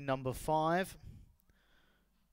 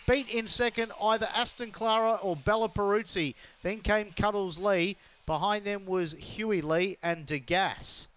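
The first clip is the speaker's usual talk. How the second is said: fast, rising live race call; race caller; 5 semitones higher, 5 dB louder than usual. Real, not acted. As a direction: call the race as it unfolds, building.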